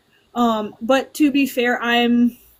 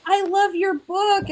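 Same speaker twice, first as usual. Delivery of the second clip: higher, slow, gushing fan voice; gushing reader; 8 semitones higher than usual. Retold or imitated. Reader telling the author they love her book, slow and high. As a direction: draw it out like a smitten reader. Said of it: "someone's like".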